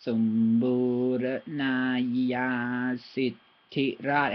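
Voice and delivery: Sombura Naya Sitirat, very slow, drawn out; slow careful syllables. Shown as a demonstration. Learner sounding out a hard Thai word, very slow. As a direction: crawl through each syllable. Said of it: "I had to go".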